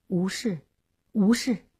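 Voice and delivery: softly evasive, with a hesitant gap; hemming and hawing. A narrator's reading. Groom dodging his bride's question, hemming and hawing. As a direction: mumble it in small, uneasy pieces. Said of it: "张秀林支支吾吾地说".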